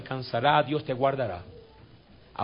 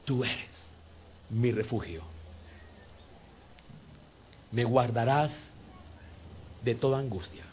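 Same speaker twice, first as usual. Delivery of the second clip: softer, lower, tender whisper-like prayer; 4 semitones lower, 7 dB quieter than usual. Shown as a demonstration. Pastor leading the church in soft prayer, gently. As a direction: breathe it gently to the Lord. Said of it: "le vas a decir suavemente".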